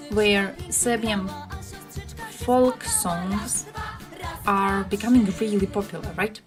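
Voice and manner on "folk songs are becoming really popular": normal talking voice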